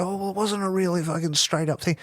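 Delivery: high, whiny skeptic; dismissive doubter. Retold or imitated. Doubters dismissing the turn, whiny high voice. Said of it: "anybody's like".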